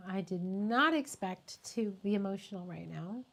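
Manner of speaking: ordinary talk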